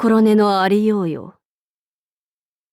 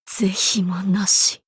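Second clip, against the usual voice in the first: soft, unvoiced final whisper; defeated demon king whispering last words; 4 semitones lower, 5 dB quieter than usual. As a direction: breathe it out as you fall.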